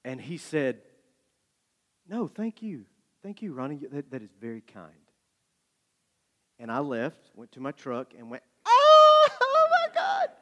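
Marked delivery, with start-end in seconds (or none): 2.1-4.9 soft, gentle, halting voice; Malcolm Gladwell. Retold or imitated. Writer thanking an admiring reader, gently. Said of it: "oh so gently he said". 8.6-10.3 very high-pitched fan freak-out; starstruck squeal. Retold or imitated. Fan alone in his truck after meeting his idol, squealing.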